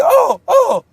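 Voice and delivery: very high, loud squeal of disbelief; piercing high "for real"; shocked disbelief. Retold or imitated. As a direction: squeak it out, stunned. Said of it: "he's like".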